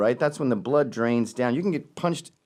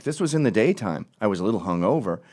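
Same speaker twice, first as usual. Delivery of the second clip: normal talking voice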